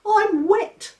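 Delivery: put-on a severe baby laying down the law; stern baby George.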